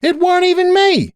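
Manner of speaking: high, insistent denial; protesting. A narrator's reading. Applejack accused by Cupcake, high protest. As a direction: deny it in a very high voice. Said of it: "Applejack protested".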